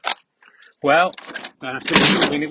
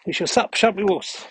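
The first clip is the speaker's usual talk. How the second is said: higher, louder doggy voice; talking to the dog; 7 semitones higher, 6 dB louder than usual. Real, not acted. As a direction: coo at the dog.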